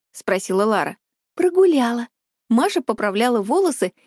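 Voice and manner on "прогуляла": breezy, higher-pitched mimicry of a friend; copying a friend's intonation. A narrator's reading. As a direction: toss it off in borrowed intonation. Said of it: "явно копируя движение и интонацию Лары".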